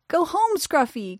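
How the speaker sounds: put-on weak child voice. A narrator's reading. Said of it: "came a weak voice".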